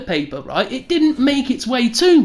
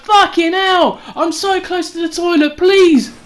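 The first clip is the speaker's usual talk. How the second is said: desperate plea; 6 semitones higher than usual. Retold or imitated. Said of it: "I was like".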